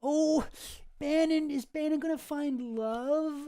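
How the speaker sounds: high, slow, swooning hopeful voice; dreamy romantic voice. Retold or imitated. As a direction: gush like a lovesick romantic. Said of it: "and you're like".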